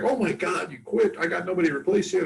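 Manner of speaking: a lightly played flustered boss; mock dismay. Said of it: "The chef is like".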